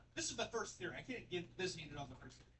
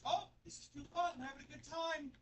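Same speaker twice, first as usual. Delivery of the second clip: giddy mock lover; 13 semitones higher than usual. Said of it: "The first two years".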